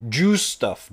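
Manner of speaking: "Juice" punched loud and high, relishing it; savored juice. Real, not acted. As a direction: belt out "Juice" as if tasting the word. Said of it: "so satisfying to say juice".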